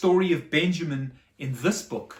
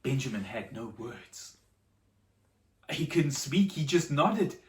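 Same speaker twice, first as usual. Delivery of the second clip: soft, lower, reverent narration; hushed storytelling; 4 semitones lower, 4 dB quieter than usual. A narrator's reading. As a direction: draw the children in quietly.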